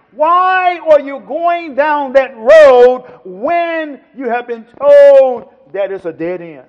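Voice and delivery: loud, stretched-out challenge; emphatic pulpit questioning; full-force preaching. Real, not acted. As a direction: hurl the question at the congregation, drawing out key words.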